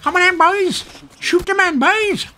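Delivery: very high, strained mock battle cry; mock chav soldier. Chav soldier rallying his boys, high mock yell. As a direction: squeal orders like a chav in the army. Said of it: "British chavs in the army".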